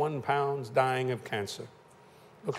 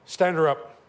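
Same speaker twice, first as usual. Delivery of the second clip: louder, firm order to stand her up; firm command; 5 dB louder, slower than usual. Retold or imitated. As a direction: command it with authority. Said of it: "Jack Coe said".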